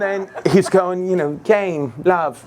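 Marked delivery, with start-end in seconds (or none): 1.4-2.4 mock British umpire's call; British accent. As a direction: announce it with a put-on British accent. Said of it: "just full British accent the whole time".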